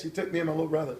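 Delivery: ordinary talk, nothing marked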